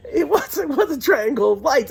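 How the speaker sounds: high, laughing retelling. Real, not acted. Storyteller confirming what they saw in the desert, laughing. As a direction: let laughter bubble through the words.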